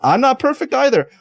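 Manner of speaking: blunt honest reassurance. Retold or imitated. Guest voicing what they tell people, high frank reassurance. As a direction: tell them straight, no sugarcoating. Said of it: "is telling people".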